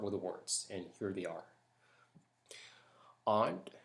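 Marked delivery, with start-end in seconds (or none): 3.3-3.7 careful single word "Aunt"; accent tag word read; word list reading. Shown as a demonstration. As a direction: read "Aunt" slowly and clearly. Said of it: "how I pronounce certain words".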